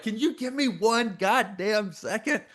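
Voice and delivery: high-pitched, drawn-out exasperation; retold temper tantrum. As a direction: replay losing it, slow and high on every word. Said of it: "had a temper tantrum".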